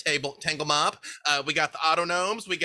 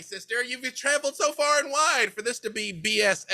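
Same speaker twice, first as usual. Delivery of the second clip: higher-pitched, fussing and apologetic; flustered little host; 9 semitones higher than usual. Acted. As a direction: scramble to save the botched welcome. GM voices flustered Kinder leader.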